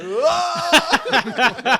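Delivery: loud high mock gasp of shock; hammed-up crowd gasp. Crowd gasping at joke.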